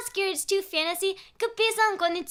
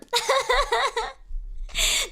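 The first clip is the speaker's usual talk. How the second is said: high, drawn-out giggling; uneasy high laughter; real nervous laugh; 8 semitones higher than usual. Real, not acted. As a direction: giggle helplessly, high and creeped out. Creeped out by own story.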